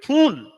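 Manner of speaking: फूल with a long loud oo; long vowel फूल. Shown as a demonstration. Teacher showing the long oo in English. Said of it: "कहाँ ह्रस्व और कहाँ दीर्घ".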